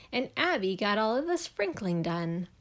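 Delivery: ordinary delivery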